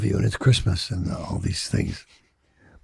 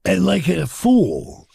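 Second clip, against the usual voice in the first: ordinary talk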